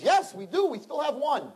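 high, bright shopkeeper; lightly voiced shop owner; pet store owner's voice. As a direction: pitch way up as the owner. Pet store owner answers Duke.

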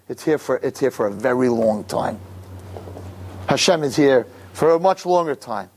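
ordinary talk

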